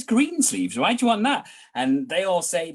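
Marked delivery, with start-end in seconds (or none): 0.0-1.5 mildly incredulous, higher-pitched query; puzzled barman. Acted. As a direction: question an odd request, pitched up.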